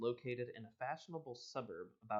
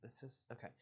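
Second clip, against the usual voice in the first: ordinary delivery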